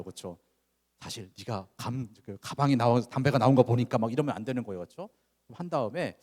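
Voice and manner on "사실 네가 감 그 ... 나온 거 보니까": louder, tense scolding through clenched teeth; through gritted teeth. Retold or imitated. Father confronting son about cigarettes, gritted teeth. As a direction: bite out the words at your son. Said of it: "막 이를 악물고서 뭐 얘기하면 안 돼요".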